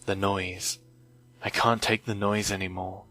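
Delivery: ordinary delivery, nothing marked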